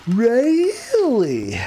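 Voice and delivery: high, drawn-out incredulous "Really?"; Jim Carrey. Retold or imitated. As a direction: stretch the word like a rubber-faced comic. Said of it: "would be Jim Carrey's".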